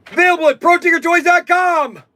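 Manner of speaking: high, loud announcer plug; ad tag. Acted. Plugging his store in a loud announcer voice. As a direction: punch it like a commercial tag.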